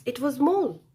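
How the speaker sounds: usual speaking voice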